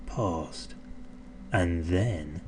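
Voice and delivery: soft, held-breath pause; hushed suspense. A narrator's reading. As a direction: whisper it, building tension. Suspense before the tickle.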